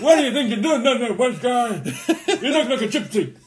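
high-pitched comic telling-off; mock indignation. Imagined Marsh berating his impressionist, high mock indignation. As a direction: scold in a put-on high voice. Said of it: "heard himself and went".

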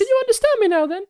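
high, chirpy little creature voice; squeaky fey creature. Acted. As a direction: play a sweet tiny fey critter. Turnip creature speaking.

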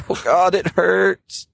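high, drawn-out mock agony; wounded man in pain. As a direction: groan it slowly in a high, pained voice. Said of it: "he's reaching for the arrow like".

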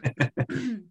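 sharp throat clear. Real, not acted. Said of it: "I keep clearing my throat".

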